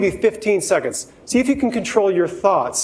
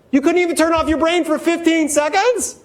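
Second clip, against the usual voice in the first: playful tease; 10 semitones higher than usual. Real, not acted. Teasing the audience.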